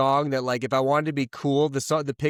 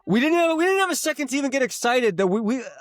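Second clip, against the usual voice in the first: much higher, rising exasperation; exasperated rant; 12 semitones higher than usual. Real, not acted. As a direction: vent it in disbelief, voice climbing. Venting about a chaotic day.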